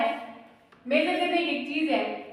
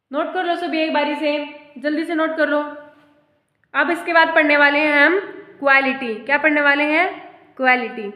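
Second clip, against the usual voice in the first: louder, calling to the whole class; calling out loudly; 8 dB louder than usual. Real, not acted. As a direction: raise the voice to get the class writing. Teacher calls out to class. (कक्षा को ज़ोर से पुकारना)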